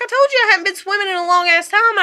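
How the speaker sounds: high, fast indignant retort; exasperated scolding. Retold or imitated. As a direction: rattle off the complaint at him. Retold scolding of husband.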